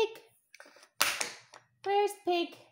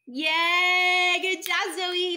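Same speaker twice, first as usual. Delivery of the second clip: cheering; 6 semitones higher, 4 dB louder, much slower than usual. Real, not acted. Praising the child.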